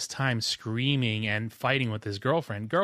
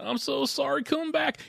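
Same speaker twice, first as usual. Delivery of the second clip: high, pleading mock sob; mock crying; 13 semitones higher than usual. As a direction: beg in an exaggerated weepy whine. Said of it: "He's on the phone crying".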